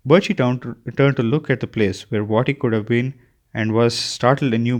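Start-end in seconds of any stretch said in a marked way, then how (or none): none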